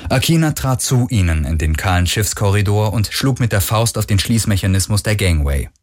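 plain reading voice

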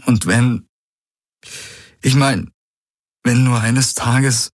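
he is close to breaking; trembling voice. A narrator's reading. Galen imagines losing Ki, voice trembling. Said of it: "Das Beben ging nun auch in seine Stimme über".

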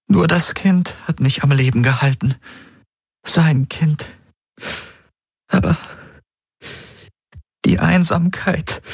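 high, halting woman's voice; long faltering pauses as the tears threaten; fighting back tears. Acted. Woman confides in him about loneliness, fighting back tears. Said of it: "die Tränen zurückzuhalten".

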